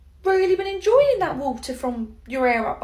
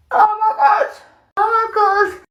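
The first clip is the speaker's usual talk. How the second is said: panicked; 4 semitones higher, 8 dB louder than usual. Acted. Shock at sneezing blood.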